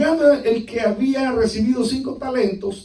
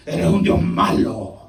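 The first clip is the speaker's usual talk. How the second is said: mock accusation; 2 semitones lower, 4 dB louder, much slower than usual. Servant accusing God.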